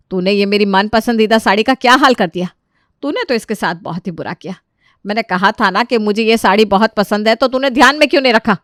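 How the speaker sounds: loud, furious scolding, speeding up at the end; angry scolding. Acted. Mother-in-law furious with her daughter-in-law over the ruined sari, loud angry scolding. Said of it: "काफ़ी गुस्सा हो जाती है".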